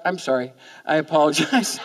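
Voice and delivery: ordinary talk, nothing marked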